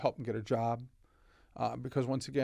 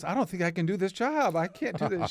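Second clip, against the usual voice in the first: high, loud, tearful pleading; imitating a salesperson in tears, raised and loud; retold crying; 7 semitones higher, 4 dB louder than usual. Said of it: "She started crying".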